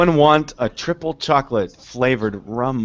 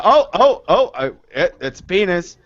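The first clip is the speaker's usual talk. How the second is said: panicked alarm; 2 semitones higher than usual. Retold or imitated. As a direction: freak out at the screen. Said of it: "They're like".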